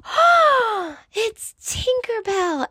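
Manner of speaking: high breathy wonder, drawn out slowly; the crowd's hushed awe at Tinkerbell; awed audience gasp. Retold or imitated. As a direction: gasp it like a thrilled crowd member. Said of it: "the audience go".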